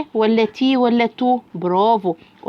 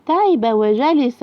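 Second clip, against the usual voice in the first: usual speaking voice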